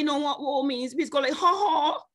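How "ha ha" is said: "Ha ha" as a higher Bajan exclamation. Retold or imitated. Preacher mimics the Bajan reaction to woe, higher in pitch. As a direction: toss off "Ha ha" the Bajan way. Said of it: "It's a Bajan ha ha".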